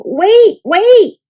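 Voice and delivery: high, loud call after the bus; loud high yell. A narrator's reading. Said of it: "he yelled".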